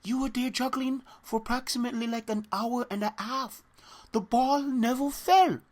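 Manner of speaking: hammed-up Arabic accent, amazed; funny accent. Yasser in Saudi accent, marvelling at the juggling. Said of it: "his accent was so humorous and funny".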